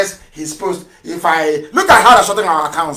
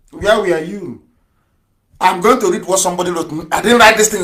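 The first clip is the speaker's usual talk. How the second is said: ordinary talk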